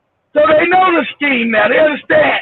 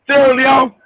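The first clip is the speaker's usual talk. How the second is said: ordinary delivery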